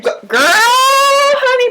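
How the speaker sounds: drawn-out high wail of outrage; slow, high-pitched exasperated cry, hammed-up; losing it. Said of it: "I was losing it".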